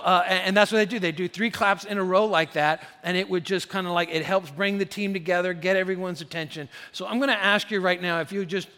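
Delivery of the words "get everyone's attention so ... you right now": ordinary delivery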